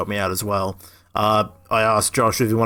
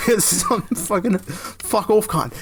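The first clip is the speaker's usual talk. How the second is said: mock Aussie swearing; 8 semitones higher, slower than usual. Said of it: "that's my fault".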